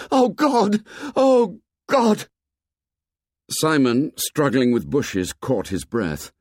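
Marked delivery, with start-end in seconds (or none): 0.1-2.3 high, loud agonized cry; anguished cry. A narrator's reading. Ralph crying out in agony over the ship, high and loud. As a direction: cry out torn by indecision. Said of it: "Ralph cried out".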